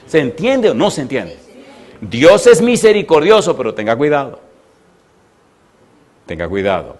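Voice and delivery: ordinary talk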